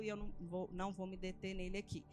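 ordinary delivery

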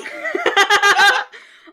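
loud high cackle, can't hold it; burst of laughter. Real, not acted. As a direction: break into a howling laugh. Explodes laughing.

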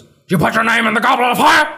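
high, urgent rising mock yell; hammed-up frantic interrogation; mock yelling Dumbledore. Dumbledore confronting Harry as in the movie, mock frantic yell. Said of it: "he didn't say it like that".

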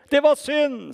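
commentator's shout; loud, high cry at a missed penalty; Arne Scheie. Retold or imitated. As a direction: yell it like a football commentator. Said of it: "Da roper Scheie".

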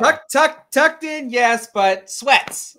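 high, loud amused protest; laughing protest. Real, not acted. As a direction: insist through the laughter. Defending his outfit, laughing.

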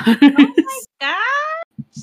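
drawn-out, high and loud laughing "oh my gosh"; real shock breaking into a laugh; shocked gasp. Real, not acted. Reacting to ghost story reveal.

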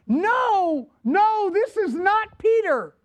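high mock shout of disdain; disdainful shout. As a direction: boys yelling they don't believe it. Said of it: "they shouted their disdain and said".

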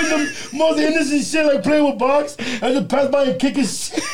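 can't keep it together while telling it; laughing. Real, not acted. Telling friends about teasing the nephew, laughing.

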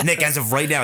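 laughing into the line; trying to talk through a laugh. Real, not acted. Laughing at Nick's break.

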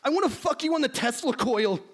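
high, hammy aroused professor; overheated nerd, played for laughs; lusty scientist voice. Plays Beekman.

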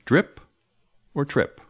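"Drip" and "trip" set high, the first sound stressed; teacher quizzing the learner on "Drip" and "trip"; high model pair. Shown as a demonstration. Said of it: "Which is it?".

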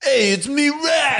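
high, shouted New York taxi driver. Retold or imitated. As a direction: belt it like a Brooklyn cabbie. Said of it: "He's like the taxi driver voice".

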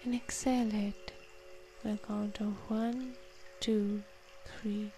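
soft, unhurried counting and calming guidance; guided meditation. Acted. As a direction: talk them gently through each breath. Leads a breathing meditation.

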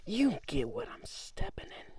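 high, breathy whisper of the wrong catchphrase; wrong phrase whispered. Shown as a demonstration. Misquoting the catchphrase to the listener in a whisper. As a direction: botch the saying in a hushed voice. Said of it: "No, you smell".

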